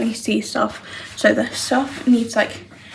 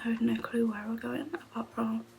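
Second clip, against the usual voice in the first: soft unvoiced whisper throughout; 6 dB quieter than usual. Real, not acted. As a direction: keep it hushed, barely voiced. Quiet whispered chat at home.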